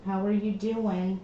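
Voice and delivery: ordinary talk, nothing marked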